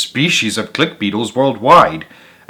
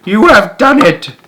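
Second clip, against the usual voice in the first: very high, loud cheer; proud shout; 10 semitones higher, 5 dB louder than usual. A narrator's reading. Wise old beetle cheers the young one's success, loud and high. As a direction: shout with pride and joy.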